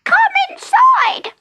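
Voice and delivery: very high, loud, slow fairy call; fairy man inviting Jenny inside, very high and loud. Acted. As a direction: call her in like a tiny magical host. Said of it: "said the little fellow".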